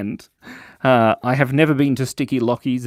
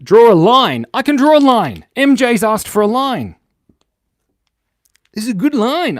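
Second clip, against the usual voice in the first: excited, loud; 11 semitones higher, 8 dB louder than usual. Real, not acted. Excited reaction to a request.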